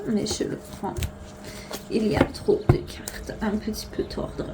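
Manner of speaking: put-on hushed, with a playful German accent. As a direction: murmur it in a mock German accent. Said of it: "je prends un accent allemand". (petit accent allemand chuchoté)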